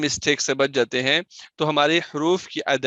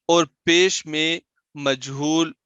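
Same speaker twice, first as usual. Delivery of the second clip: ordinary delivery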